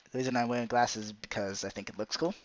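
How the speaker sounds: normal talking voice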